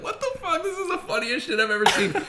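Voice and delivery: high squeaky laughing voice; giggling high-pitched what the fuck; laughing disbelief. Retold or imitated. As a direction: squeal it while cracking up. Retold laughing fit.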